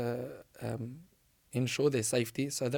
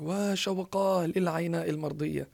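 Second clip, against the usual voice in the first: slow, high cry, only mildly raised; retold scream; 5 semitones higher than usual. Boy screaming for Al-Ayna, mild retold scream. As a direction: imitate a longing cry, drawn out and high. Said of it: "he's screaming, saying".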